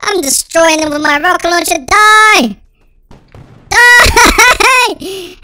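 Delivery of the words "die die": loud, high gamer war cry; battle yell. Real, not acted. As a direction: yell it as you fire. Firing rocket launcher at enemies.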